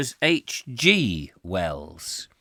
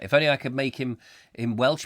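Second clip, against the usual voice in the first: usual speaking voice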